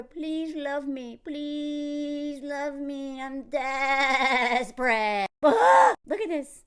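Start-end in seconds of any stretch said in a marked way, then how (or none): 0.0-5.3 drawn-out, hammed-up plea; very slow exaggerated pleading; mock desperate begging. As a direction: stretch every word into a desperate wail. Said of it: "asking him to love her".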